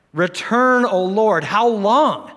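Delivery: loud, high, begging cry to God; loud pleading cry. Acted. Preacher voicing the psalmist crying out to God. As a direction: cry out as if desperate for rescue. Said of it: "He pleads out".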